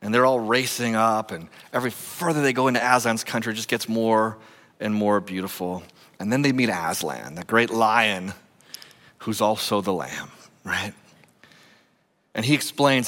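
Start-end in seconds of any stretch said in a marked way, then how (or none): none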